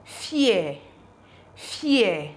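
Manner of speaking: "fear" said alone as fee-eh, falling; "fear" as an isolated teaching word, no final r; pronunciation demo. Shown as a demonstration. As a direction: model "fear" as fee-eh, pausing between repeats. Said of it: "This is pronounced as".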